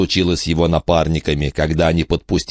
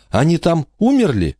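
put-on girl voice; 10 semitones higher than usual. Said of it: "сказала Бронвин".

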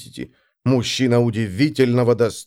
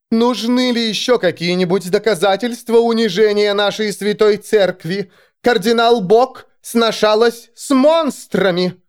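high, outraged public accusation; thundering denunciation; 10 semitones higher than usual. A narrator's reading. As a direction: denounce it to the crowd, slowing at the end. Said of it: "прогремела она".

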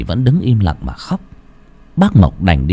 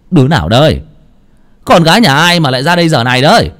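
loud, quick call into the dark; shouting out; 6 semitones higher, 4 dB louder, faster than usual. A narrator's reading. Uncle Mộc calls out at a figure in the temple, loud shout. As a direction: bark at the shadow to scare it off. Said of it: "bác Mộc mới quát lên".

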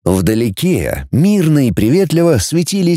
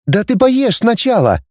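calling after him; 10 semitones higher than usual. A narrator's reading. Girl urges departing boy to eat, calling. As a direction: call after someone leaving, pitched up. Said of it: "крикнула ему девушка вдогонку".

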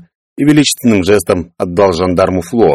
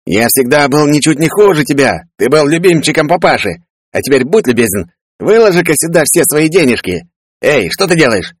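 higher, brash greedy brother; a cocky, grabby brother, pitched up; Peller voiced; 8 semitones higher than usual. Greedy brother demanding his share of money, brash higher voice.

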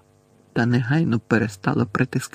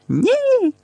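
very high-pitched squeal of protest; piercingly high desperate cry; cracked screech; 4 dB louder than usual. A narrator's reading. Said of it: "заверещала надтріснутим голосом Герміона".

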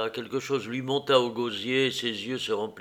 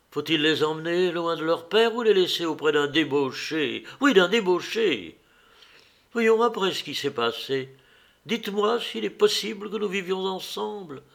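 raising her voice; 7 semitones higher than usual. Acted. Wife demanding an answer from her husband, voice rising. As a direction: push harder until it becomes a shout. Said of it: "répéta-t-elle en élevant la voix".

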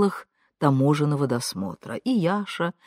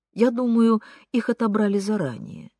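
ordinary delivery, nothing marked

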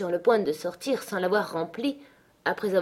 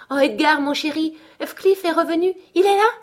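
high, loud cries building to a peak; overexcited; 10 semitones higher, 7 dB louder than usual. A narrator's reading. Wife telling her husband of the friend's return, wildly excited. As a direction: burst out with the news, climbing higher. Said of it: "trop excitée pour laisser paraître sa joie".

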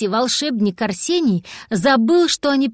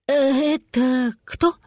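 slow, halting, stunned question; squeezed out; 3 semitones higher, much slower than usual. A narrator's reading. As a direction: force the words out with hesitation. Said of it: "выдавил Арсений". (выдавить с запинкой)

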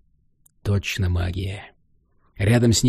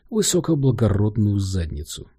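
ordinary delivery

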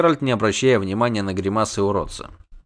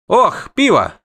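high, loud sigh of longing; 9 semitones higher, 5 dB louder, slower than usual. A narrator's reading. Halfling spots the beer arriving, sighing. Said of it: "вздохнул Низушек".

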